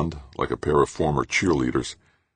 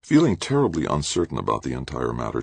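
ordinary delivery

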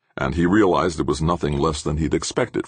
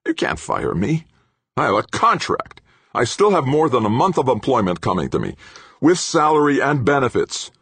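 higher-pitched, defiant gloating; malicious defiance; 11 semitones higher, 4 dB louder than usual. A narrator's reading. Fired engineer citing his contract, maliciously defiant. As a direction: defy the boss with malicious relish. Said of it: "Obermann smiled maliciously".

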